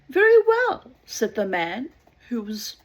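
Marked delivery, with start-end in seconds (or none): 0.1-0.9 high call. Acted. Drowning man agrees.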